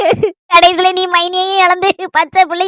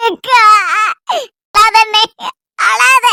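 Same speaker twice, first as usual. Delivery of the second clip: tearful pleading; much slower than usual. Acted. Sister pleading through tears with her crying brother not to cry.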